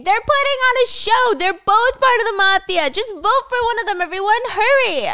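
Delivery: very high, loud urgent scream. Acted. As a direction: scream to rally everyone to vote now. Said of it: "Mina's screaming and she's saying".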